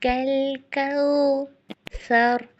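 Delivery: कल कौसर slow with smiling kaf. Shown as a demonstration. Teacher demos smiling kaf in कल कौसर. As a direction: read कल कौसर slowly with a smile on kaf. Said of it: "काफ को smiley face से पढ़ा करें".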